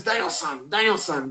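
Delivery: soft imitation of a Japanese accent; Mister Miyagi. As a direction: quietly mimic a Japanese-accented mentor. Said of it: "His Japanese accent, so he says".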